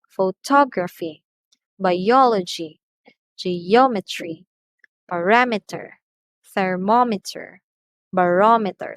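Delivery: Photography, biology, geometry, parameter, thermometer, barometer, each punched on the third-last syllable; stressed syllable demo. Shown as a demonstration. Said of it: "notice how I change the pitch of my voice".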